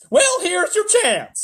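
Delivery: high, loud, slow snarl. A narrator's reading. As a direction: snarl it like an old nanny. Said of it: "snarled Miss Tibbs".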